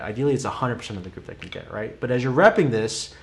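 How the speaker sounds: usual speaking voice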